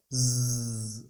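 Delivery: long, low buzzing Z; sustained soft "zzz" demonstration; bee-like buzz. Shown as a demonstration. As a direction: buzz like a honeybee, drawn out. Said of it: "తేనెటీగ buzzing".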